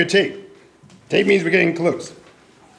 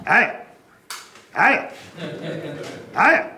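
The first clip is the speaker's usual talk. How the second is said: each "Higher." pitched further up; rising "Higher." calls that climb higher each time; going higher. Shown as a demonstration. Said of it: "Help me out here".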